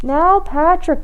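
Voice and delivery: Granny calling out; cried out. A narrator's reading. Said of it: "cried Granny".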